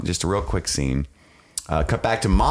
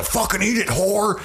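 mock yelling mom; 14 semitones higher than usual. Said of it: "Then we cut to".

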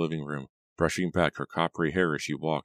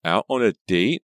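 loud, high incredulous question; crying out; 5 semitones higher, 8 dB louder than usual. A narrator's reading. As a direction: exclaim it as a startled question. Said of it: "Reva cried".

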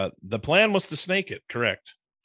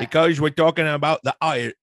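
louder, higher go at an Irish accent; attempted Irish accent; 5 semitones higher, 5 dB louder than usual. Shown as a demonstration. Cohost showing off for the Irish draft theme, attempted Irish accent. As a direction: have a bad stab at sounding Irish. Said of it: "I can't do an Irish".

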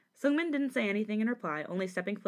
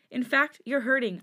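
higher, louder, mildly distressed; voice breaking; 6 semitones higher, 6 dB louder than usual. A narrator's reading. Sungmin pleads with Jisung, voice breaking. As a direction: let emotion push the voice up. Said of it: "voice began to break down a bit".

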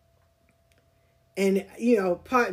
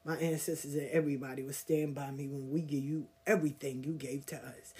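quiet, low, cold threat; a low warning said through the teeth; low menacing threat; 5 semitones lower, 8 dB quieter than usual. Retold or imitated. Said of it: "But I said it in a, another way".

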